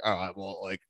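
softly talking himself into it; low, hushed pretend pep talk to yourself; mock self-persuasion. Man convincing himself his powers will kick in, hushed mock musing. Acting out himself before the jump.

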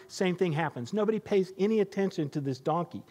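usual speaking voice

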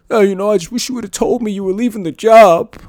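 high, loud whiny mock voice; hammed-up offended ex-colleague complaining. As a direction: whine it like a wounded coworker. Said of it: "I got a motherfucker going".

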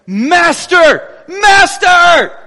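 terrified sailors yelling over the wind; desperate shout. Retold or imitated. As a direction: scream it over a roaring storm. Panicked disciples wake Jesus in storm.

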